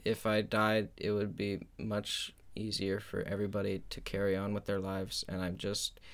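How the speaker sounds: soft, low, sinking into dejection; mock self-pity. Host blaming himself for the last episode, mock dejected. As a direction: mumble it like a hammed-up burden to everyone.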